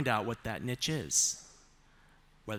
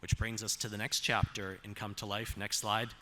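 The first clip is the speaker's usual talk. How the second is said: usual speaking voice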